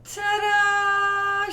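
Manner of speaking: high held ta-da; ta-da reveal. Real, not acted. Showing viewers the finished board: high excited ta-da. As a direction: present it like a magic trick. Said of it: "showing the finished board".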